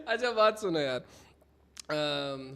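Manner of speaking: ordinary talk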